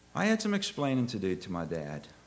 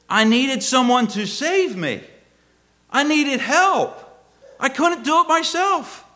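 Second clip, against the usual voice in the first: loud, high, drawn-out pulpit cry; impassioned preaching; 10 dB louder, slower than usual. Real, not acted. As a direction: cry out the need for rescue to the congregation. Preaches at full force.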